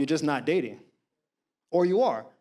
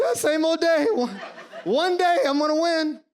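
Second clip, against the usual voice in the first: hopeful dreamer; 9 semitones higher, 3 dB louder than usual. Retold or imitated. Voicing his younger self.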